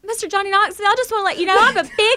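excited fangirl. Retold or imitated. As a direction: gush like a nervous fan. Retold fangirl self.

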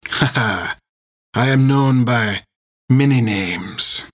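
put-on amused French-accented captain; chuckle, then answer in a French accent; thick French accent. A narrator's reading. Captain answers who he is, amused French accent. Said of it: "a voice with a thick French accent".

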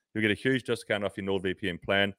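usual speaking voice

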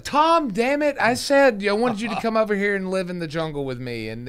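high, loud mock scolding wife; nagging wife. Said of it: "I can't do a South African accent".

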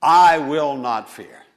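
a slow bold restatement with rising conviction; emphatic declaration. Shown as a demonstration. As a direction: declare it boldly the second time, slowly. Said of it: "And notice he said".

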